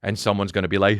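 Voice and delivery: usual speaking voice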